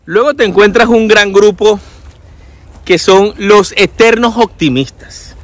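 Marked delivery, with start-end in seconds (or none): none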